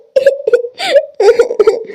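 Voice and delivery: a choked, crying laugh, pitch jumping high; tearful laugh. Acted. To her nani, laughing through tears about mother.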